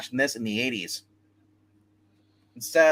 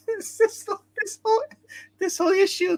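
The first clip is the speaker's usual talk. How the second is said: words squeaked out through laughter, much higher; cracking up mid-sentence; laughing squeal. Real, not acted. Breaks into laughter before story.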